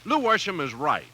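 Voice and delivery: ordinary talk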